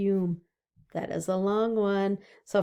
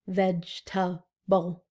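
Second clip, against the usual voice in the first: slow Vegetable, each beat apart; syllable split; 2 semitones lower than usual. Shown as a demonstration. Teacher to kids, counting syllables slowly. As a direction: stretch out Vegetable into its beats. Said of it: "What about vegetable?".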